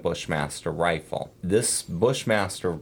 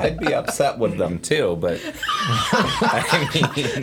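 laughing; 7 semitones higher, 4 dB louder, slower than usual. Real, not acted. Laughing while joking about swearing.

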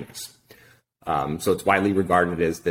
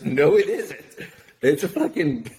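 exasperated correction; 7 semitones higher than usual. Retold or imitated. Girlfriend correcting his geography.

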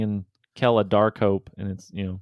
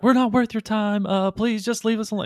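high pleading voice; 11 semitones higher than usual. Retold or imitated. GM voices player's plea.

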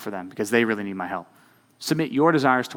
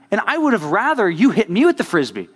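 worked-up, higher-pitched protest; mad about it; 6 semitones higher, 4 dB louder than usual. Real, not acted. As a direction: argue it with real indignation. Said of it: "I'm mad about it now".

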